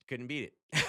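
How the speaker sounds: ordinary delivery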